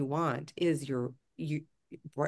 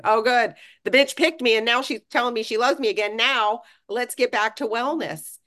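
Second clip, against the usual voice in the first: higher, louder, faster gleeful cell voice; cells talking; 6 semitones higher, 4 dB louder than usual. Retold or imitated. Your body's cells cheering that she finally loves them. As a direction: voice the relieved cells, brisk and chirpy.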